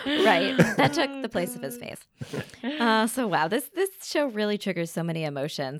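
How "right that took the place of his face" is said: laughing. Real, not acted. Laughing joke reply.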